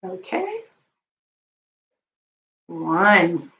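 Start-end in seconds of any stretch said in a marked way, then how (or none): 2.7-3.5 slow calm count. Acted. Slow relaxing count over music.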